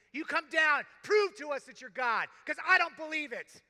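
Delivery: loud, high, sneering challenge that slows at the end; the first criminal taunting God, loud and scornful. Acted. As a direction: jeer at God with full scorn. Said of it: "Taunting God".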